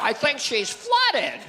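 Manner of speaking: high, very slow put-on drawl; character punchline. Man on a date reports trouble, high slow drawl.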